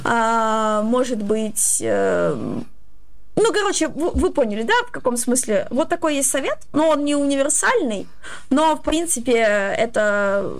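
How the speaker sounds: normal talking voice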